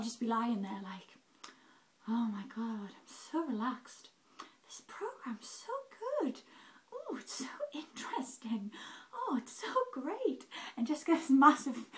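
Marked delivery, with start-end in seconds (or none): 2.1-10.5 slow, breathy, swooping higher in exaggerated bliss; mock blissed-out. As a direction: coo it like someone drugged and utterly relaxed. Acts out being blissed out on tramadol.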